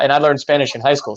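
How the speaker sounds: ordinary talk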